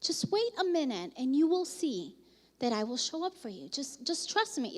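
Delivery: soft, hushed reassurance; quietly calm someone who is worked up; God reassuring. Retold or imitated. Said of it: "and God is like".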